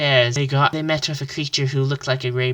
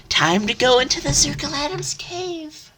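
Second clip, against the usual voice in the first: high character voice; 12 semitones higher than usual. Acted. Character Myokai speaks.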